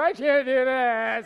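slow, stretched-out comic wail; mock breakdown. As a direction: whine it out like a hammed-up tantrum. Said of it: "get on the floor and go".

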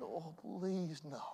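ordinary talk, nothing marked

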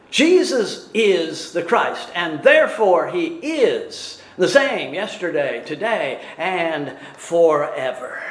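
deliberate, emphatic statement of faith; verb stressed in both places. Shown as a demonstration. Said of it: "Why can't it be in both places?".

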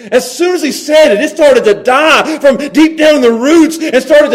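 driving, high-pitched sermon voice; full-force preaching. Real, not acted. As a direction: preach it with building urgency.

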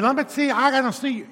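put-on a light Southern accent for the church growth expert. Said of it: "they talk like that 'cause they're from the South".

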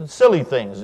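usual speaking voice

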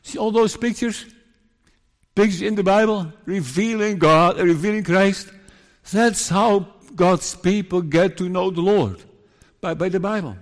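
high-pitched, emphatic pulpit delivery; fervent preaching. Real, not acted. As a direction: drive the point home to the congregation. Preacher drives the point home.

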